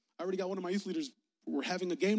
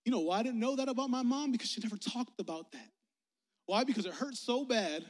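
normal talking voice

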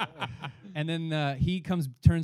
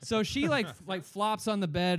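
usual speaking voice

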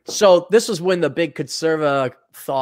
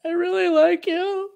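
much higher, put-on woman's voice; mock girly let-down; 14 semitones higher than usual. Gal breaking it off with the short guy, mock high voice. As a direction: pitch it way up like a girl gently dumping someone. Mimicking women letting him down.

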